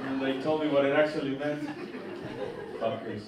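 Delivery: usual speaking voice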